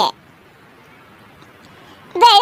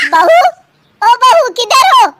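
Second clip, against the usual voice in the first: loud, higher summons; raised searching call; 6 semitones higher, 5 dB louder than usual. Acted. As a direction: call through the house for someone. Mother-in-law calls for absent bahu.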